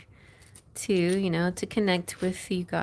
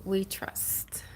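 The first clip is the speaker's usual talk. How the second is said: whisper; 9 dB quieter than usual. Real, not acted. Playful motto for Cheryl.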